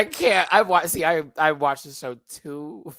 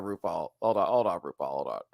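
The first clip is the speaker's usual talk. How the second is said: usual speaking voice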